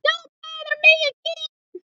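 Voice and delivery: very high, loud squealing pig voice; angry little pig shrieking; rude pig shouting. A narrator's reading. Third pig shouting rudely at the wolf to leave. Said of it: "what that rude little porker answered".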